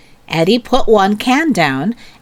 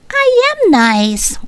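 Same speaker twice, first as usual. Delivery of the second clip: a high, slow, indignant snap; snapping. A narrator's reading. Melody snapping at Lisa who doubts she is nice. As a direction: stretch it out, offended and higher. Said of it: "Melody snapped".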